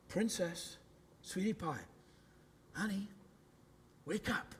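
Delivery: very soft, tender coaxing; gentle endearment. Acted. Jesus standing over the little girl, gentle and intimate. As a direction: softly rouse a sleeping child, pausing between words. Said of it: "that gentleness, that intimacy".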